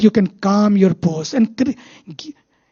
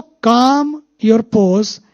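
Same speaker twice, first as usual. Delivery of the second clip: So calm your pose said very slowly; slow mantra; much slower than usual. Shown as a demonstration. Teacher closing the point, slow deliberate mantra. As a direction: let each word sink in. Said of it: "that's what meditation does".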